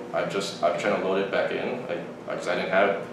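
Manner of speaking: low, soft retold explanation; meek excuse. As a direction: mumble a defensive excuse. Acted meek excuse to pushy girl.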